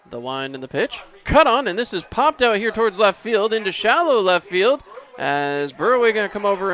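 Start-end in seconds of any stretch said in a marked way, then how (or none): 1.2-6.7 raised, loud live call; excited play-by-play. Real, not acted. Announcer calls a pop-up to left field, excited play-by-play. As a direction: lift pitch and volume as the ball is hit.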